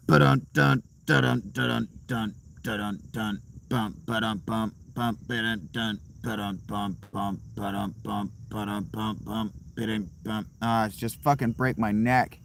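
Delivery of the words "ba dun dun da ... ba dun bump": beatbox the bass line; vocal bass riff. Pretend. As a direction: chant the riff, fading down. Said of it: "this bass riff".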